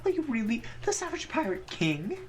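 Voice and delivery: high, put-on questioning voice; showy, high-pitched storybook wonder; awed theatrical character. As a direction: address the ghost in a high dramatic voice. [name] addresses the ghost in game.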